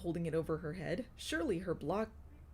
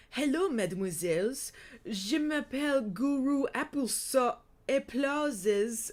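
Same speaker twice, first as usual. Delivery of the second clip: hammed-up French accent, louder and higher; bad French accent; 5 semitones higher, 5 dB louder than usual. A narrator's reading. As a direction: greet them as a theatrical, butchered-French host. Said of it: "I can barely do a French accent".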